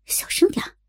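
urgent whisper. Acted. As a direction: whisper sharply to hush him. Hushing him at the table.

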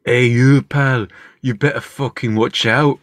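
slow, hammed-up Scottish hard man; Glaswegian threat. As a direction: warn him off like a Glasgow heavy. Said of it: "do they go".

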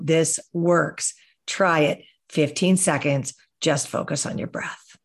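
calm guided breathing. Acted. Guiding listeners through fifteen seconds of breath, calm meditation voice. Leads a short breathing exercise.

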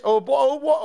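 high, exaggerated British accent; mock British accent. Co-host showing his only British phrases, in mock British accent. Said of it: "a British accent".

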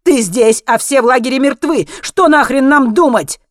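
high, very loud, agitated outburst; angry shouting. A narrator's reading. Alex confronts Henry about the dead camp, shouting. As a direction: yell it as a furious challenge. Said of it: "выкрикнул Алекс".

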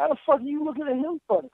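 high, fast indignant question; incredulous scolding. Acted. Fan scolding wrestler for blaming him, incredulous. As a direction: fire it off high in disbelief.

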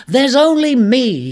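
loud, high, slow bark. Acted. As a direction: snap it at him. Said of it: "he barked".

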